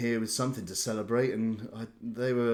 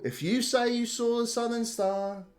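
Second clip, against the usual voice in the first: lightly voiced innkeeper, higher and placating; giving in; 10 semitones higher than usual. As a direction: throw up your hands and concede. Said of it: "he holds up his hands and goes".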